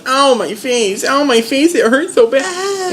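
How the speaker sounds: high whining complaint in pain; whiny Sam. Retold or imitated. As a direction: whimper it up high like a hurt baby. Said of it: "Sam's like".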